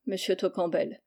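ordinary delivery, nothing marked

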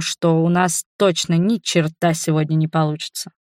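plain reading voice

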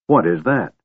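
a shaken voice cracking with dread; the words break as fear takes over. A narrator's reading. The dwarf Flint staring at the figure, voice breaking. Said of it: "his voice breaking".

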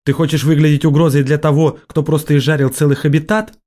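high-pitched, indignant exclamation; exclaiming. A narrator's reading. Kyoln rebuking an officer who objects, exclaiming. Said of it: "воскликнула Кёльн".

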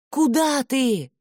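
high, slow call. A narrator's reading. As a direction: call after him, pitched high. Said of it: "позвала Айсет".

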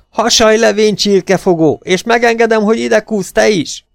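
loud, high-pitched yelled command; shouted order. A narrator's reading. Bobby orders Pityú to crawl over during gunfire, shouting. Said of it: "ordította Bobby a kémény mögül".

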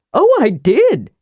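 high, drawn-out eager voice; Tass speaking. A narrator's reading. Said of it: "said Tass".

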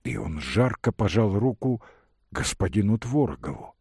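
usual speaking voice

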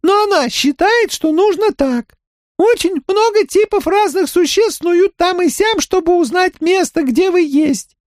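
very high monotone alien voice; high, flat and mechanical, like a crab creature. Acted.

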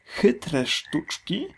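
slow, rising incredulous repeat; disbelieving echo. Acted. Small crocodile doubting the big one's cunning plan, slow and incredulous. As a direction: drag it out in disbelief. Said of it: "powtórzył z niedowierzaniem nie tak wielki".

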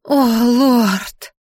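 hammed-up swooning sigh, drawn out; breathy languid voice. A narrator's reading. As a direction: moan it with mock-sultry breath. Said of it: "с придыханием томным голосом". (с придыханием, томным голосом)

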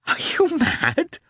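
disbelieving, stretch each word in shock; young woman exclaiming in vexed disbelief; vexed exclamation. A narrator's reading. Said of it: "she exclaimed, flushing with vexation".

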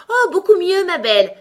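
very high, loud piercing exclamation; shrill cry. A narrator's reading. Queen answering Alice about her finger, shrill cry. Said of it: "la voix se fit de plus en plus aiguë".

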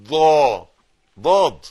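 ضاد with a loud, doubled ض; emphatic ض. Shown as a demonstration. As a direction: press hard on the ض. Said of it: "شدة وفتحة".

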